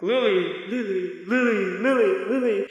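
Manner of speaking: imitated movie echo, fading repeats; echoey voice. Movie ripple transition calling Lily, echoey voice. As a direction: repeat the name like a dreamy echo. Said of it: "you hear the echoey voice".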